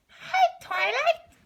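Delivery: very high, loud, eerie chirp; disturbing voice. A narrator's reading. Narrator voicing creepy Pinkie greeting Twilight, very high and unsettling. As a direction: greet her like something is badly wrong. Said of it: "Pinkie said in a disturbing voice".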